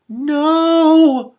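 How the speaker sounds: high, loud desperate "No!"; anguished cry. Acted. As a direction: cry out in distress. Shiara crying fire, distressed.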